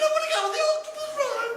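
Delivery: put-on very high, whining childish plea; whiny high voice. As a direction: beg like a sulky child. Percy voiced in joke.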